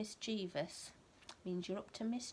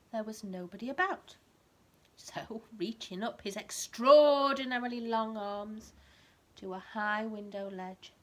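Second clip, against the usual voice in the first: lively bedtime story reading, rising on the key words; reading to a child; 7 dB louder than usual. A narrator's reading. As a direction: read it like a picture book to a toddler.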